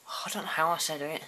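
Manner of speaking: ordinary talk, nothing marked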